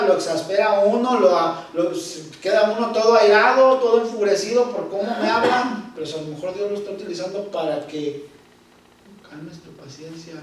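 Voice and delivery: ordinary delivery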